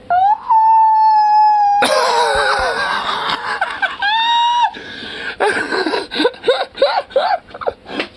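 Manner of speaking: long high screaming laugh; howling, crying laughter; shrieking laugh. Real, not acted. Reacts to racist trailer moment.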